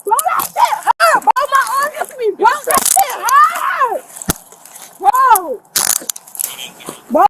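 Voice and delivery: usual speaking voice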